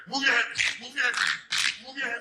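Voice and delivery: high, loud barked drill; imitating Teddy training. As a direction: shout like a trainer mid-workout. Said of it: "he's like".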